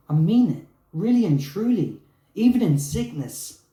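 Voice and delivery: acted-out heartfelt vow at full voice; impassioned declaration. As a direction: declare it with mounting fervour. Retold impassioned proposal to partner.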